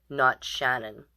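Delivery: ordinary delivery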